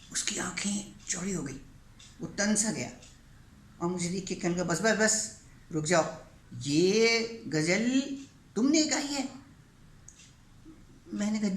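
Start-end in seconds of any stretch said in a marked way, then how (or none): 4.6-9.3 astonished. Retold or imitated. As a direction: stop him short in stunned amazement. Chief stunned on hearing the ghazal.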